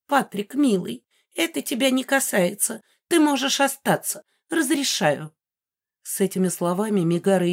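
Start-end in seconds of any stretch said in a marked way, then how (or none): 0.1-5.3 high, softened doting aunt; sweetly gracious. A narrator's reading. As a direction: coo to a beloved nephew in a high voice. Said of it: "и лицо её поменялось".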